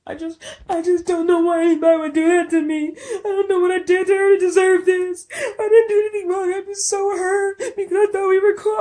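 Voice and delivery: high, whiny fake sobbing, no tears; mock fake crying. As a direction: ham up a wailing victim act. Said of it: "How you crying with no tears?".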